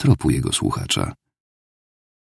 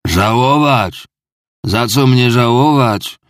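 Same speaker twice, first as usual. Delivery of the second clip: higher, slow, louder outburst; sudden outcry; 7 semitones higher, 5 dB louder, slower than usual. A narrator's reading. Drunk clerk bursts out at the tavern keeper, loud and slow. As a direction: burst out as if inspired. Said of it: "wrzasnął znagła Marmiładow".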